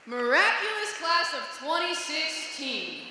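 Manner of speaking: high, loud, drawn-out rallying call; rousing cheer. Real, not acted. Speaker hails the graduating class, rousing cheer. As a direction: hail the class loudly, stretching every word.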